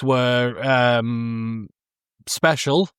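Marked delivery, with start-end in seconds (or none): none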